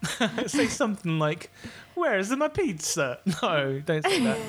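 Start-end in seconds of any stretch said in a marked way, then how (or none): 1.9-3.2 slow, higher-pitched mock Italian; Italian accent. Shown as a demonstration. Suggesting a silly test line in a mock Italian accent. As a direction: drag out a hammy Italian accent. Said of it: "give me something to say with an Italian accent".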